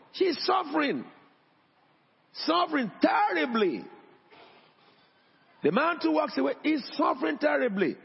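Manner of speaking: high, impassioned preacher's cry; preaching at full force. Acted. Pastor preaching to the church about suffering couples, high and impassioned. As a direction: lift the voice and plead with the congregation.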